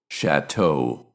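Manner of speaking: ordinary delivery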